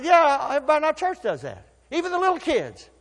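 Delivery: high, laughing brag about tithing; retold pastor proudly laughing; amused boast. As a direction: brag it with a chuckle.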